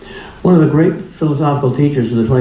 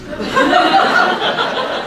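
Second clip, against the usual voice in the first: breathy burst of real laughter; delighted laugh. Real, not acted. Laughs at own quote.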